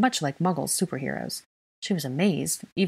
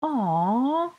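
high, drawn-out protesting whine; little-child whine, sliding upward; whining child; 9 semitones higher than usual. A narrator's reading. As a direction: whine it like a disappointed small kid. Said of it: "Aurelian whined".

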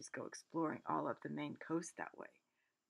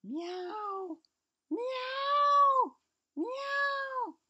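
high, loud, drawn-out meows; cat meow; 9 dB louder, much slower than usual. Acted. Narrator meowing like a cat in the dark. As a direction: yowl like a prowling cat.

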